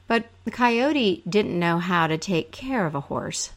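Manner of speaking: plain reading voice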